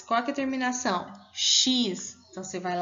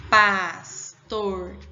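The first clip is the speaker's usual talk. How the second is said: Pastorzinho broken slowly into pas, tor, zi, nho; slow, separated syllables in Pastorzinho, starting loud; split into syllables; 2 semitones lower, 6 dB louder, slower than usual. Shown as a demonstration. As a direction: say Pastorzinho syllable by syllable, pausing after each. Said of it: "Vamos separar pastorzinho? Como é que vai ficar?".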